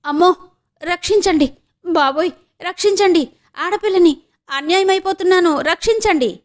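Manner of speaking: high pleading screams for help, faked; girl fakes an attack to lure the watchman, crying for help; feigned cries for help. A narrator's reading. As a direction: cry out for rescue at the top of the voice, pretending. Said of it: "అంటూ కేకలు వినిపించాయి".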